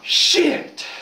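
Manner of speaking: high, drawn-out comic curse; hammed-up pained swearing; mock cursing. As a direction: let the swear burst out as if you hit your thumb. Father curses after hitting thumb.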